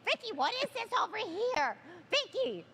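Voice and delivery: high, pleading whine of a fussing husband; mocking whiny husband. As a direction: nag over every little ache. Wife mocks husband's constant complaints.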